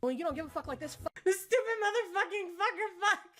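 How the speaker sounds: high, fast cursing through laughs; laughing mock rant. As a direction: spit the curses out, breaking into laughter. Her rant heard by Curtis.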